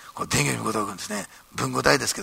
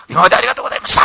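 formal loud farewell; 6 semitones higher, 10 dB louder, much faster than usual. Retold or imitated.

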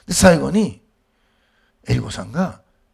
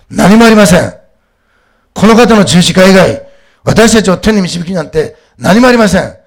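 emphatic, raised sermon voice; forceful preaching; 7 semitones higher, 10 dB louder than usual. Real, not acted. Preacher insisting that only Christ's cross leads to heaven, forceful preaching. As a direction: proclaim it loudly to the congregation.

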